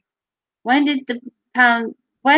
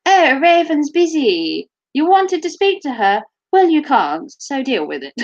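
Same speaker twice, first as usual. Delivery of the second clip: posh ringtone lady; 3 semitones higher than usual. Acted. Ringtone lady turning a caller away, posh. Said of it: "my posh ringtone lady".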